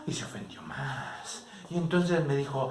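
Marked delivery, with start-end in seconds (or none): 0.0-1.5 hushed whisper to the listeners; whispered aside. A narrator's reading. Aside to the audience. (susurro en confidencia)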